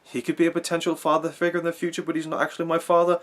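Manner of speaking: imitated character thinking it through aloud; Rey musing. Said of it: "Is she saying".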